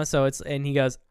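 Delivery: ordinary talk, nothing marked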